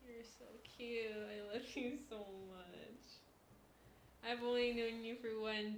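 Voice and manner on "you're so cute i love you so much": slow soft loving coo; tender. Real, not acted. Streamer telling her cat she loves him, slow tender coo. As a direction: melt over the cat, slowly and softly.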